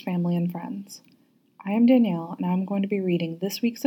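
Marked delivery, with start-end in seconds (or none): none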